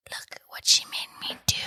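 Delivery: eerie breathy whisper, no voice at all; ghostly whisper. Retold or imitated. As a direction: whisper it like a ghost in the dark kitchen. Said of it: "a whisper in the kitchen".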